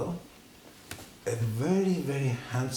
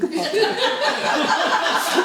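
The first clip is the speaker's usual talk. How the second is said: breathy laugh; 6 dB louder than usual. Real, not acted. Laughing at his own story.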